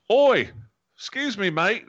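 put-on high, loud, overdone Australian accent; big high Australian holler, hammed up; hard, pushed Australian accent. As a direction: imitate a bad actor forcing an Australian accent. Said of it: "like an actor trying to work on his Australian accent".

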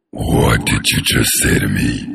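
very low, slow breathy whisper; deep menacing whisper; creepy deep whisper. Shown as a demonstration. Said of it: "Yeah, I could do that".

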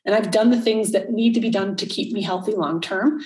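ordinary talk

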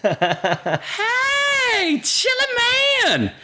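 very high, stretched holler; high-pitched mimicked shout; imitated call-out. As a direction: mimic someone hollering across a room. Quoting the woman calling out to him.

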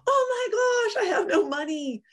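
high, panicked "oh my gosh"; reliving the money panic, pitch shooting up; a replayed flash of panic about money; retold panic. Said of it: "now I'm in another panic".

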